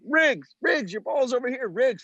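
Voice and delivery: put-on high calling voice of eager kids; mock kid shout; imitating kids calling. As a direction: call out to him like a pair of kids.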